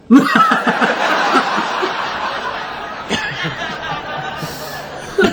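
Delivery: breathy wheezing laugh. Real, not acted. Joker wheezing after the cat punchline, laughing.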